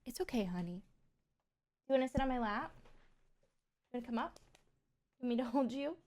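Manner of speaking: soft, gentle comforting aside; real soothing. Real, not acted. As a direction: coax softly, offering comfort. Comforting someone scared by thunder.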